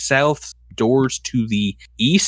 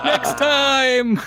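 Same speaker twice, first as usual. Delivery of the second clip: villain voice; 12 semitones higher than usual. Acted.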